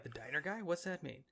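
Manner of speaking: low, soft imitated question; puzzled aside. March wonders about Healy's nickname, quiet and puzzled.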